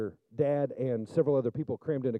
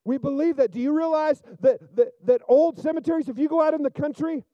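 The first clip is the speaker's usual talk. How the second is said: higher and louder, soaring sermon voice; preaching at full force; 10 semitones higher, 7 dB louder than usual. Acted. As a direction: preach it loud and fervent to the congregation.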